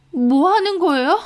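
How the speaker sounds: high, aghast outburst; shocked protest. Acted. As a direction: protest sharply at what was just done. Victim protests as phone is smashed.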